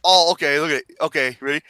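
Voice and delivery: usual speaking voice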